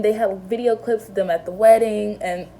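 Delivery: ordinary talk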